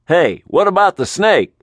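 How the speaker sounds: high, loud call after him; calling manager. Acted. Manager calling after the departing Mitch, high and loud. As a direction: call out anxiously as he leaves.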